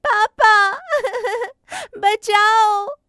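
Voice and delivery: high, loud scream to the parents; cry for help. Acted. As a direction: call out for rescue in panic.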